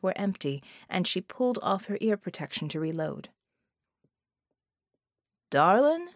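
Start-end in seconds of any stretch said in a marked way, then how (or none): 5.5-6.1 stretched-out darlin', higher and louder; drawl. A narrator's reading. Genie calls to her at the range, drawling. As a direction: lean on it like a lazy charmer. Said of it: "the genie drawled".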